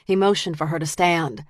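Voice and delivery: ordinary delivery, nothing marked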